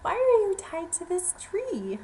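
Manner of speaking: high, loud, slow surprised ask; surprised question. Acted. Mouse asks the trapped lion, surprised and loud. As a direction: sound amazed to find him tied.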